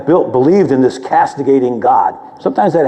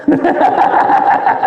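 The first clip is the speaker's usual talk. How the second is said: amused laugh. Real, not acted. Laughs at the priest's answer.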